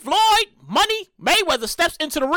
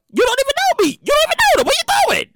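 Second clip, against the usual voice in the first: high-pitched indignant challenge; exasperated confrontation; 11 semitones higher, much faster than usual. Acted. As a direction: confront them, pitch jumping up in disbelief. Mock confronting presumptuous strangers.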